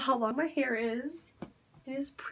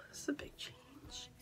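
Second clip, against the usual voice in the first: whisper; 21 dB quieter than usual. Real, not acted. Sighing over haircut decision.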